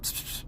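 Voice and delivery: soft, voiceless attention-getting hiss; secretive psst. Retold or imitated. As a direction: hush them into a huddle with a psst. Secretly calling kids into huddle.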